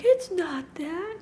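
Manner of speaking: small, high, slow mock-sad child voice; soft retold voice of an upset daughter; imitating quiet crying. Daughter quietly crying to her mother, imitated sadness. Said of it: "was, like, quietly crying".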